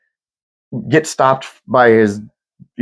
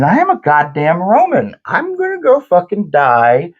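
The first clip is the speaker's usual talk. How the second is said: higher-pitched, mock-heroic declaration; defiant Roman general; 6 semitones higher than usual. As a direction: declare a glorious death like a proud general. Acting defiant Roman general's last stand.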